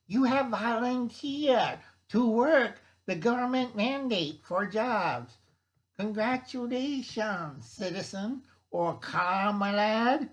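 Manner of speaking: high, loud, slowing cartoon commissar; mock state official. Government official congratulating forced workers, mock commissar voice. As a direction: announce it like a smug propaganda official. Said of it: "I can't do the weird voices".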